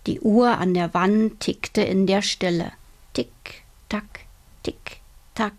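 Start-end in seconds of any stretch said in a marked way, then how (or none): 3.1-5.5 whispered ticking. A narrator's reading. Narrator whispers the clock ticking in a silent night room. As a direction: tick and tack breathed out in a whisper, very soft.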